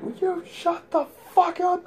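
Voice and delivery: high, loud retort; angry shout back. Retold or imitated. Him to the bunkmate shouting at night, angry shout. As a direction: yell it across the bunks, high and hard.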